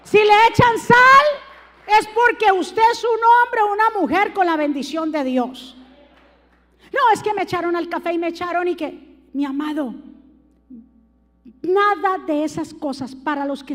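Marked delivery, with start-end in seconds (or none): none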